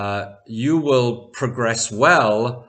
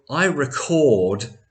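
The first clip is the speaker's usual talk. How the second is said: slow, higher "record" as re-CORD; verb stress on second syllable; 3 semitones higher, 3 dB louder, slower than usual. Shown as a demonstration. Teacher points out the verb after the subject, "record" stressed at the end. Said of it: "is going to be a verb".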